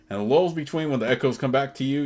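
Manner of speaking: normal talking voice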